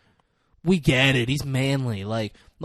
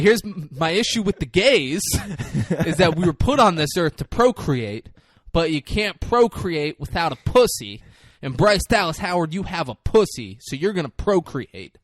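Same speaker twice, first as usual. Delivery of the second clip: loud, higher-pitched mock sermon; mock preachy mom; 4 semitones higher, 6 dB louder than usual. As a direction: lay down the law as a preachy mom. Mock lecture as the mother.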